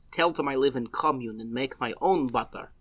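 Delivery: soft, put-on Russian accent; deadpan imitation Russian accent. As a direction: speak as a mock Russian villager. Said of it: "talk with the Russian accent".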